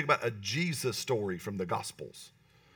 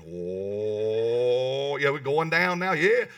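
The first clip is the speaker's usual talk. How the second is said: MMA hype person; 6 dB louder, much slower than usual. Retold or imitated. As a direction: pump up the crowd like a fight announcer. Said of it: "You're like an M M A hype person".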